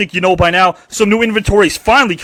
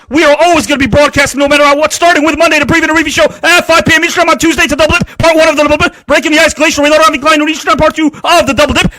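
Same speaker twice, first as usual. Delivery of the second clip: high, loud promo read; rapid-fire hype host listing shows; hyped announcer rundown; 8 semitones higher, 5 dB louder than usual. Acted. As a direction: sell the whole weekly lineup like an announcer. Hyped show schedule rundown.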